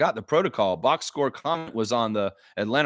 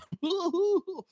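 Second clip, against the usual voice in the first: high, goofy put-on chuckle; hammed-up high hooting laugh; cartoon laugh; 5 dB quieter than usual. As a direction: laugh like a silly cartoon character. Cartoon laugh mocking Americans.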